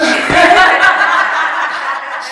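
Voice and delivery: a high, loud burst of real laughter; big laugh. Real, not acted. Laughing at how she sent him back to Athens. Laughs at his own punchline.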